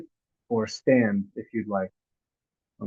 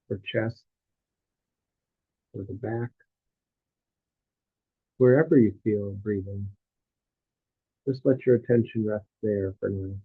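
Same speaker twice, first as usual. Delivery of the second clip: guided meditation; 2 semitones lower than usual. Real, not acted. Teacher guiding listeners through a body scan, calm meditative delivery.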